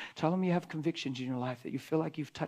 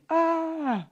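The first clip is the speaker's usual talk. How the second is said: awed ah; 13 semitones higher, 6 dB louder than usual. Acted. Awed exclamation about the Lord.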